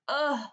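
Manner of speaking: loud, high "ugh" exhale; angry, voiced huff; frustrated sigh. Shown as a demonstration. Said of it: "if you're frustrated or you're angry".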